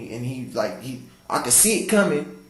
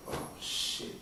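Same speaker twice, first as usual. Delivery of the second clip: breathy whisper under the breath; whispered oh shit; 14 dB quieter than usual. Retold or imitated. Man seeing guns drawn whispers to himself. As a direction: whisper it in alarm. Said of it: "I'm like".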